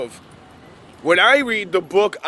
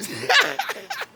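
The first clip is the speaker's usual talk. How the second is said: breathy high giggle; a short airy giggle; high laugh. Real, not acted. Laughing at his own impression of a churchgoer, breathy and high.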